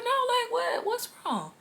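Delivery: high squeaky pressing question; husband pleading in a high mock voice; mock worried husband. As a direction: push him to say what's wrong in a high pitch.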